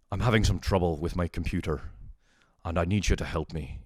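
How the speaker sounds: imitated Northern Irish caller; Northern Irish accent. Northern Irish caller asking tech support for help, imitated accent. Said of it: "the accent that Liam Neeson has".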